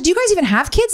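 high, sarcastic challenge; high incredulous jab. Retold or imitated. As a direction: scoff at them in a high voice. Exasperated at midwives.

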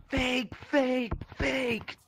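high, loud mocking jeer; mock shouting in a high squawk; imitated heckler yelling. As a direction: yell it like an accusing crowd, high and repeated. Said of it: "I don't want people yelling".

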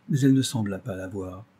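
usual speaking voice

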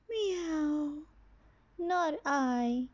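snooty bored cat. Acted. Cat refuses again.

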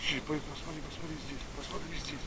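soft coaxing search cue; talking to a dog. Real, not acted. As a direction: quietly urge her to sniff here. Urging dog to sniff.

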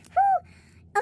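sharp, high, loud whoo of delight; high excited whew. Real, not acted. Reacting to the find.

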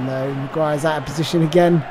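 ordinary delivery

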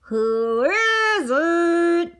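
slow, drawn-out fake old lady; mock granny voice. Wolf disguised as granny answering, slow and hammed-up. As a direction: stretch it out like a pretend granny.

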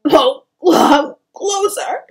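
loud, slow, anguished oath; drawn-out wail of a distressed mother; tearful cursing. A narrator's reading. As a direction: curse through the tears. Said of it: "Spelda cursed".

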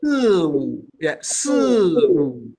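Si stressed, wu slow; slow model with first stressed; stress first syllable. Shown as a demonstration. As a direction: stretch "si wu" slowly, si strong. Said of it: "put more emphasis on the first one".

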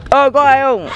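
high-pitched mock call for anyone around; imitated call-out. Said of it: "phải học theo anh Tư".

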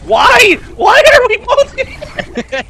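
desperate shout. Real, not acted. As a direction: cry out in despair.

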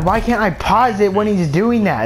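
ordinary talk, nothing marked

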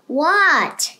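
loud falling shout; child yelling back; yelled. A narrator's reading. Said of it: "yelled Froggy".

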